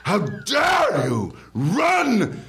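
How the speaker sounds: high, slow, furious scolding; shaking with anger. Pretend. Plays a scolding teacher.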